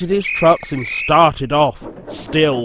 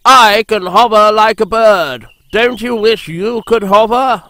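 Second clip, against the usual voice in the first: high, loud showing-off voice; boastful helicopter; 7 semitones higher, 6 dB louder than usual. A narrator's reading. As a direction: brag about hovering. Harold boasting.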